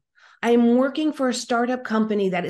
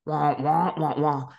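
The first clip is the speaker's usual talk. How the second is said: muffled wah wah; 4 semitones lower than usual. Retold or imitated. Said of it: "And I hear".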